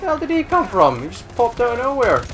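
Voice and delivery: angry outburst at an enemy who popped out of nowhere; furious yelling. Real, not acted. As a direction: rage at the ambush. Ambushed in the game.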